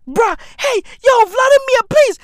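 high, loud pleading shout; exasperated calling out. Acted. Comedian appealing to Vladimir Putin, exasperated shout. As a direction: yell up high to get his attention.

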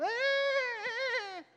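high, slow whimpering wail; mock child wail. Preacher imitating a boy crying as he runs off, high mock wail. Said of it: "ele chora e sai correndo".

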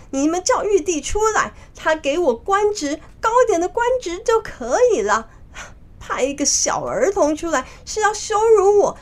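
high-pitched indignant outburst; angry. Acted. Monkey King insulted by a child opponent, high and angry. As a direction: rant at the insult, voice jumping high. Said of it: "孙悟空就更生气了".

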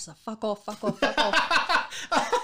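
drawn-out, loud, laughing dismissal; laughing mock fuck off. Answering cheery Christmas posts, laughing drawn-out dismissal. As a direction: stretch it out, laughing at yourself. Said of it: "And I'm like".

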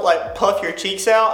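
ordinary talk

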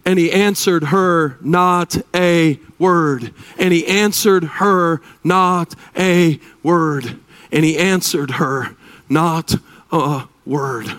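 the preacher drops low and slow to stress the silence; slow, heavy reading. Shown as a demonstration. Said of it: "Verse twenty-three, gentlemen".

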